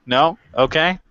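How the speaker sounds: ordinary delivery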